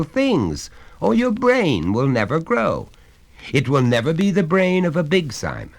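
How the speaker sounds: a hearty father coaxing his son; genial father. Acted. Father reasons with the boy.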